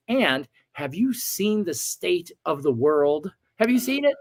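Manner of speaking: slow, then a sharp high Have you seen it; indignant outburst. Retold or imitated. Angry man challenging the angel, voice leaping high. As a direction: demand it, voice jumping up at the end. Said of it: "the next thing I said".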